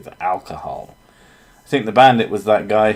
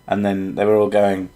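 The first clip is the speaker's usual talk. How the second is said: ordinary talk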